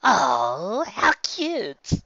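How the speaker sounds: high sing-song sneer; mock cute. A narrator's reading. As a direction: coo it with mockery. Villain mare mocking.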